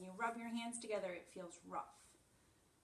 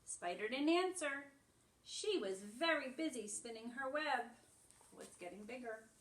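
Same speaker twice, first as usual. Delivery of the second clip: lively higher storybook reading; animated picture-book read-aloud; reading to children; 4 semitones higher than usual. Acted. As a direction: read it brightly to little ones. Reads picture book to class.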